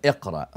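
iqra said as Arabic "ik-ra", low and falling; Arabic word iqra. Shown as a demonstration. Guest glossing the Quran's first word, Arabic pronunciation.

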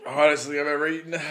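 loud, fast, pained; pained exclamation. Real, not acted. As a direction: cry it out with a burning mouth. Reacting to extreme heat.

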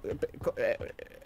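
normal talking voice

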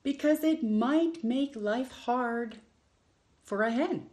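lively picture-book read-aloud with long pauses; reading to children. Acted. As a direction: read it like story time for little ones.

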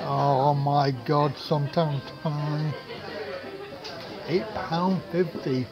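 ordinary delivery

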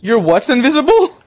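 loud, high disbelief; incredulous question. Real, not acted. Gamer asking a teammate, loud and disbelieving. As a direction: ask it in disbelief.

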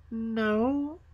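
put-on high, small girl's answer; little Gerda. Gerda answers the robber girl.

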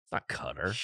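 unvoiced whispered aside; hushed correction under the breath. Real, not acted. Correcting a mispronunciation.

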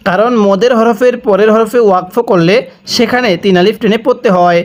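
ordinary talk

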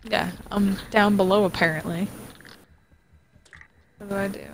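ordinary talk, nothing marked

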